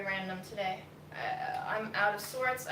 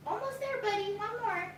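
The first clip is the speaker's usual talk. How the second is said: encouraging a pet; 9 semitones higher than usual. Real, not acted. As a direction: coax the pet through it. Encouraging pet being held.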